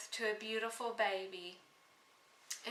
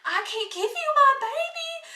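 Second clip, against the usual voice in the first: high, loud frightened cry of a mother; 8 dB louder than usual. Acted. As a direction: panic as he reaches for her baby. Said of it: "she was so frightened".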